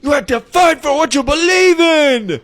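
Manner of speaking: a fired-up anime hero, shouted very high and loud. Retold or imitated. Imitating an anime guy from a gym TikTok audio, shouting. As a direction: scream it like a shonen protagonist. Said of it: "some anime guy being like".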